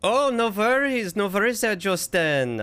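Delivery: high, lilting mock-German; put-on German accent, pitched well up; vaguely German accent. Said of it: "Vaguely German is what I use".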